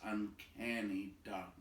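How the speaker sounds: ordinary delivery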